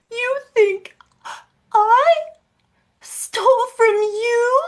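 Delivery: shrill parody of a fake-innocent sister; mocking impression. Mocking her sister's fake innocence in a high voice. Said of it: "The worst acting in the world".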